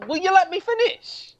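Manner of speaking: high-pitched exasperation at being interrupted; exasperated protest. Real, not acted. Co-host interrupted about Sam Pepper, exasperated protest. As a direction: demand the floor, pitch shooting up. Interrupted repeatedly by co-hosts arguing.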